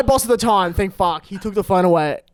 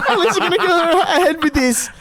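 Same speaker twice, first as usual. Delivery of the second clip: higher, giggly take on the caller; laughing mock repeat; 8 semitones higher than usual. Podcaster retelling the Optus call after slipping into accent, laughing mock repeat. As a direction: redo the caller's line through laughter. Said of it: "I sort of did the accent again".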